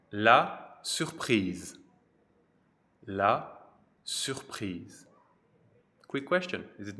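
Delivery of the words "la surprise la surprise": La surprise, very slow and drawn out; slow model word. Shown as a demonstration. Teacher modelling La surprise slowly for learners. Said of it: "teacher models the noun".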